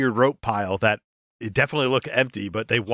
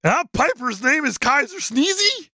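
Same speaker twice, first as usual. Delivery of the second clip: high dopey creature voice; goofy high-pitched monster; Sasquatch voice; 12 semitones higher than usual. Acted.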